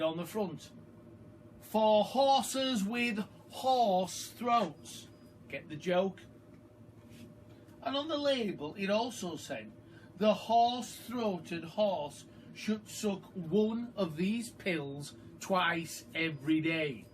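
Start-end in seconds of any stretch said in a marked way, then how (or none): 1.6-5.1 very slow, louder and higher; label read out. A narrator's reading. Reader reading the horse pill label, very slow and grand. 10.2-17.1 label read out. A narrator's reading. Reader reading the horse pill label, very slow and grand.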